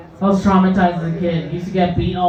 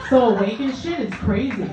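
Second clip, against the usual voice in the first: normal talking voice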